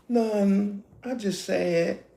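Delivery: soft, put-on pouty sadness; mock tearful sulk; fake tears. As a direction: fake being hurt to draw him in. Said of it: "See how she turned on the tears?".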